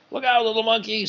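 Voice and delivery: high call of warning; warning yell. A narrator's reading. Spectator warning the speeding monkey, high urgent call. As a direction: cry out a warning from the crowd. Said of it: "someone yelled from the crowd".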